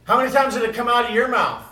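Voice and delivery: loud, high and fast challenge; loud fast preaching. Real, not acted. Preacher loudly challenging the congregation, quick. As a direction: fire the question at them quickly.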